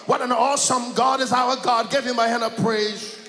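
loud, rising sermon cadence, calling out to the church; whooping gospel preacher building to a peak; full-force preaching. Acted. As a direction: preach it up with the music behind you.

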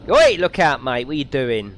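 loud "oi" at a driver; mock shout. Tourist shouting at a driver who nearly hits him, loud. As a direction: call out sharply to someone in the road.

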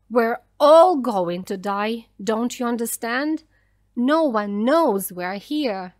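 loud, despairing outburst; angry shout. A narrator's reading. Daniel telling the mother all will die, angry shout. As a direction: hammer it home loudly, slowing at the end. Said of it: "he shouted at her".

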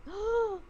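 high sharp gasp of awe; overwhelmed gasp. Retold or imitated. Recalling the light beings, an overwhelmed gasp.

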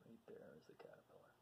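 quick, hushed whisper; whispered aside to children. Acted. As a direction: point it out in a secret whisper. Pointing at picture, whispering.

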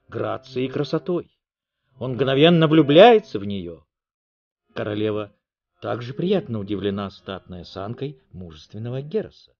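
ordinary delivery

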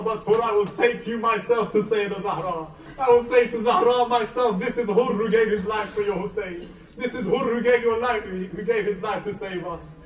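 loud, high, tearful-edged proclamation; impassioned retold promise. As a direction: proclaim the vow at full preaching force. Retold fervent promise, climax of sermon.

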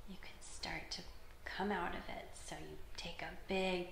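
very soft, near-whispered; hushed wake-up. Real, not acted. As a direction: ease them out of meditation very softly. Easing children out of meditation.

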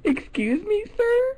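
high, shocked excuse me; mock indignant. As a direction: pitch it up in fake outrage. Caller acts indignant.

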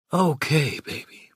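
hoarse, rough voice dropping to a whisper; husky okay trailing into a whisper. A narrator's reading. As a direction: rasp it out, then fade into breath. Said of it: "Meine Worte klangen heiser und rau".

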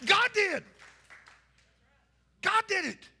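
usual speaking voice

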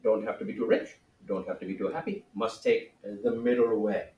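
soft, half-whispered chant; hushed mantra. Acted. Sage intoning the middle way, hushed. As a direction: murmur it like a sacred mantra.

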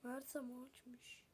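slow unvoiced whisper; hushed whisper while holding back. Real, not acted. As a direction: breathe it out slowly, barely voiced. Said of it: "içimi tuttum".

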